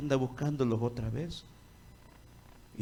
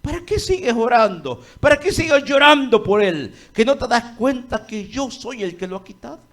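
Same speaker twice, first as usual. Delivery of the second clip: God rebuking, loud; 7 semitones higher, 10 dB louder than usual. Acted. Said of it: "¿Qué dice Dios?".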